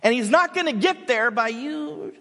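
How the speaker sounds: loud, high, forceful rebuke; stern coach scolding. Retold or imitated. Coach scolding the assistant coach, loud and stern. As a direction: insist he must be tough. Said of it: "Denzel's like".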